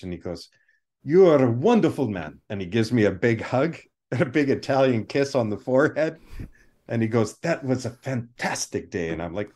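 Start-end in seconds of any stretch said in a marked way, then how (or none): 1.0-2.4 warm Italian director praise; Italian accent. Retold or imitated. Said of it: "heavy Italian accent". 7.4-9.1 put-on Italian accent. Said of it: "heavy Italian accent".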